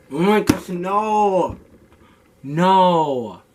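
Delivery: loud high cry of dismay; real dismay at a bad grade; shocked outburst. Real, not acted.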